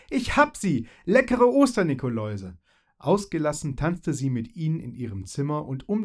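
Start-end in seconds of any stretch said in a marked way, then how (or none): 0.1-2.6 high, loud cry of triumph; jubilant. Acted. Fairy holds up her found chocolate, jubilant shout. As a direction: crow over the prize.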